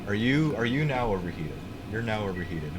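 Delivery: usual speaking voice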